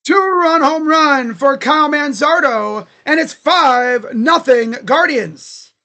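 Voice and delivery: loud, slow announcer-style recap; play-by-play call. Acted. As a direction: announce it like the broadcast booth. Fan announces home run result.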